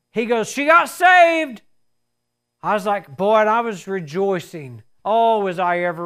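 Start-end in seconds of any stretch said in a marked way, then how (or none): 0.5-1.6 high, loud, slow joyful cry; joyful shout. Retold or imitated. Pastor announcing the conversion, loud and joyful. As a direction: shout the good news out.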